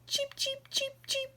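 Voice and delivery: high soft cheeping; tiny chick cheeps; baby chicks. Acted.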